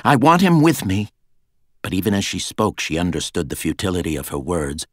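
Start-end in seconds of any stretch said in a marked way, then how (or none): 0.1-1.1 high, loud, curt demand; terse insistence. A narrator's reading. Girl insisting to her guards on having Jack, terse. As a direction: insist on it, voice lifted well up. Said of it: "she said tersely".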